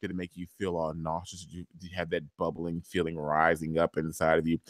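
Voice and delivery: soft, low, hushed taunt; taunting whisper. Retold or imitated. Papa Pope taunting Quinn, hushed and soft. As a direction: lean in and hiss it quietly. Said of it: "He's like".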